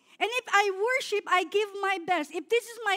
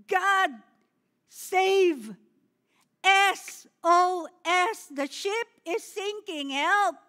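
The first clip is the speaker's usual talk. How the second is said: loud panicked shouts, SOS drawn out slowly; desperate cry for help; 5 dB louder, much slower than usual. Retold or imitated. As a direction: call out like a sinking ship's crew. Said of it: "the only thing that we can say is". (malakas na desperadong sigaw ng saklolo)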